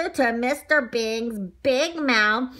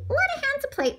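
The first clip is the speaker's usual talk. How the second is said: very high little donut voice; donut character; faster than usual. A narrator's reading. Donut admires his new plate, high-pitched character voice. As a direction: pipe up as a tiny donut.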